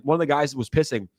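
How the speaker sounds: normal talking voice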